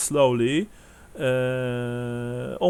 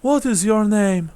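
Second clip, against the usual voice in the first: put-on high, slightly louder old woman's question; elderly widow's raised pitch; grateful old woman; 8 semitones higher, 4 dB louder than usual. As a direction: ask in a high old-lady voice. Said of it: "she says".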